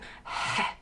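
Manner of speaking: sharp breathy demo of the letter H; voiceless huff demonstrating an H; exhaled H sound. Shown as a demonstration. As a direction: puff out an exaggerated H. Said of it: "we don't do that".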